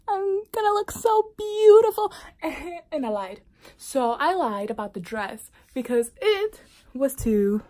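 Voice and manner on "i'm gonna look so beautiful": high, loud gushing excitement; excited. Retold or imitated. Bride daydreaming about her wedding look, high and gushing. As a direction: squeal it with giddy confidence.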